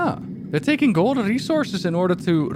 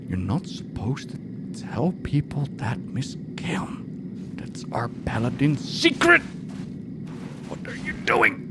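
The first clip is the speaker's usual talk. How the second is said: hushed, half-whispered pretend scolding; play-acted furtive whisper; mock secretive hiss; 7 dB quieter than usual. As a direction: scold under your breath as if guarding a secret. Plays panic over a leaked secret.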